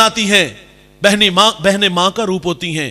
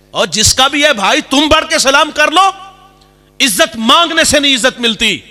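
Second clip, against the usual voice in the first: high, loud, impassioned appeal; rising into a full preaching shout; 7 semitones higher, 5 dB louder than usual. Real, not acted. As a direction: cry it out to the crowd. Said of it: "preacher shouts his exhortation".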